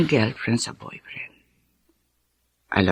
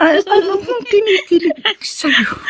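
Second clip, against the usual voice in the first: higher, slow, laughing through the words; laughing denial; 13 semitones higher, slower than usual. Acted. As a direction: shyly laugh off the tease. Mother laughs off teasing. (natatawang pagtanggi, mas mataas at mabagal)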